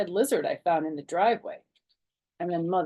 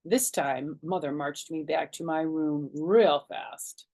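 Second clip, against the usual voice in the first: usual speaking voice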